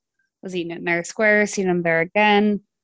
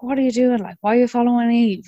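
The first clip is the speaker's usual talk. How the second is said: loud, demanding challenge; angry confrontation; 4 semitones higher, 4 dB louder than usual. Retold or imitated. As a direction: call him out sharply. Friend confronts the stalker.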